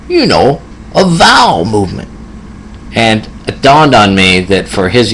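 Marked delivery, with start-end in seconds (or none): 0.1-2.1 put-on high old man voice, in earnest; serious little old man. Old patient earnestly repeating it to his nurse, little old man voice. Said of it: "he was really serious".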